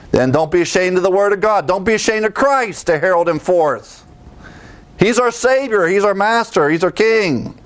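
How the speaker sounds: preacher building to a peak; fervent preaching. Real, not acted. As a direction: proclaim him with fire.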